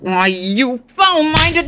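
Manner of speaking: high, slow, building indignation; outraged. Acted. Niece accused of wanting inheritance, outraged. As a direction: spit out the insult, pitch climbing. Insulted niece slaps detective.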